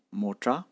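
Mocha said with cha rising, set against the English motor; tone contrast. Shown as a demonstration. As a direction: give mocha its Tibetan rising tone.

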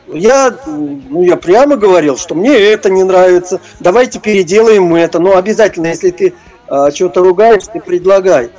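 normal talking voice

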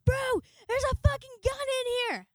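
very high, loud mock-panicked yell; freaking out. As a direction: re-enact a drunk panic at spotting a gun. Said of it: "I'm freaking out about the gun".

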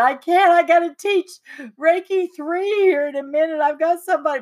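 high, wailing retold protest; hammed-up tearful helplessness; mock crying. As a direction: imitate yourself fretting through tears. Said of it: "sitting here crying".

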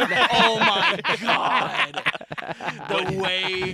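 high, loud laughing exclamation. Real, not acted. Laughing at the joke.

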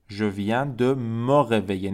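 "Le métro vient de partir" said slowly, each word separate; slow, careful French for learners; slow model reading. Shown as a demonstration. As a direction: drill it slowly, stressing vient. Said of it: "reads French model sentence".

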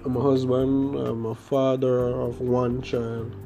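ordinary delivery

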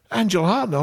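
high, excited fan voice; starstruck gush. Retold or imitated. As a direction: gush over her name like a fan. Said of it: "And he goes".

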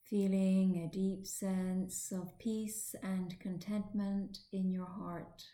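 slow meditation voice, long pauses; guided meditation. Acted. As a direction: ease the listener slowly into stillness. Leads guided relaxation.

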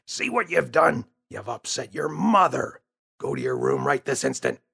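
put-on dad sending the boy to his room; stern father. Father scolds James.

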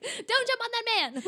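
high, loud warning; mock alarmed shout. Mom stopping daughter jumping near a man, mock alarmed shout. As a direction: call out in hammed-up parental panic.

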